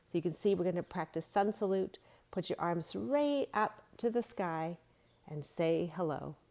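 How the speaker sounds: usual speaking voice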